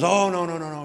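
high, loud mock panic, "no, no"; alarmed refusal. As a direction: play the man backing away fast, voice shooting up. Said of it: "And he goes".

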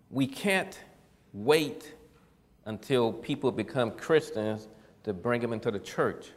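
deliberate, broken up with long pauses; measured first statement. Real, not acted. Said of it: "Do I need to say that again?".

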